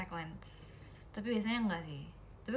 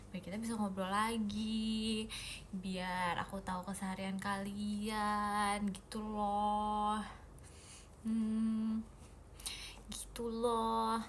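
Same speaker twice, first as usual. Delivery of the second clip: slow sweet talk; 2 semitones lower, 6 dB quieter, much slower than usual. Real, not acted. Sweet answer to fans.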